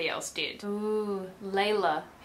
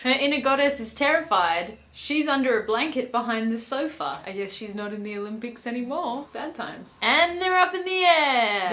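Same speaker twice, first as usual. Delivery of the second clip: ordinary talk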